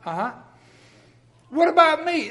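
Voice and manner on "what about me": higher, louder self-centred complaint; whiny mock objection. Mock congregant interrupting Doug, whiny. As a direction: play the listener wanting his share.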